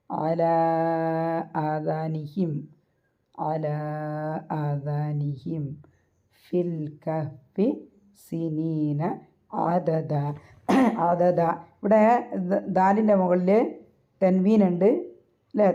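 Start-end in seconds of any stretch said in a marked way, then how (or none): 0.1-5.6 slow recitation, the end of "അലാ" held for four counts; four-count elongation. Shown as a demonstration. Teacher showing the four-count madd, "അലാ" drawn out long in slow recitation. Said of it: "four count നീട്ടിയിട്ട് pronounce ചെയ്യുക". 6.5-11.4 slow, measured tajweed recitation; Quran recitation. Shown as a demonstration. Teacher modelling the verse for students, slow careful recitation.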